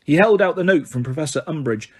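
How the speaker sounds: ordinary delivery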